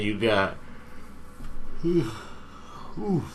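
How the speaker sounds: talking through a big yawn. Real, not acted. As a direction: yawn and stretch mid-sentence. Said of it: "yawning and stretching".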